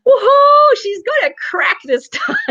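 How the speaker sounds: very high, loud gleeful cheer; mock excited whoop. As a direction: whoop it up, breaking into laughter. Voices the counselor's eyebrow reaction, laughing.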